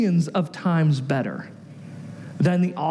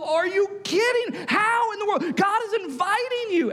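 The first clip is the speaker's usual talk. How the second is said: high, exasperated disbelief; mock outraged astonishment; acted incredulity; 12 semitones higher than usual. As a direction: protest as if nobody could be that foolish. Readers react to the spies' refusal.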